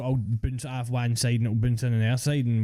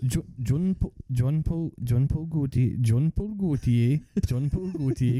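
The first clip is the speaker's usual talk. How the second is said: mock Geordie accent; 2 semitones higher than usual. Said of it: "Is that a Geordie accent?".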